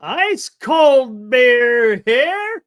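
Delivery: slow, loud drawn-out beer call; stadium vendor hawking. Retold or imitated. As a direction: stretch it out like a beer man. Vendor imitation.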